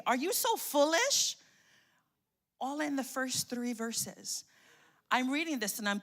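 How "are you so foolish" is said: higher incredulous rebuke, stress on so; mock rebuke. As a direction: rise in disbelief on so. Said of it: "Then he goes on to say".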